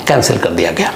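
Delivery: ordinary delivery, nothing marked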